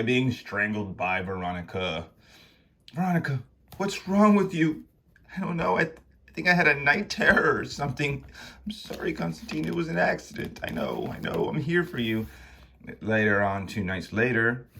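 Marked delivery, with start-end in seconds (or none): 2.9-4.8 higher-pitched, startled demand; alarmed young man. Acted. Konstantin alarmed by Veronica's attack. 5.4-12.3 lightly voiced distressed apology; a touch higher, pleading a little; mildly shaken figures. Characters talk after night terror.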